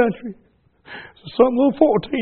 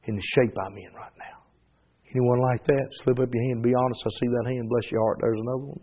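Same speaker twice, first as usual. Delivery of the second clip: hushed altar call; 4 semitones lower than usual. Acted. Preacher inviting bowed congregation to respond, hushed and lower. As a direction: gently coax hands up in the hush.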